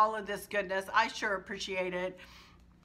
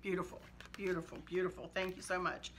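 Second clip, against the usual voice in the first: low-key, hushed thanks, much softer; softly moved; 3 semitones lower, 8 dB quieter than usual. Real, not acted. Thanking a friend for an Easter card, softly moved. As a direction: murmur it softly, touched by the words.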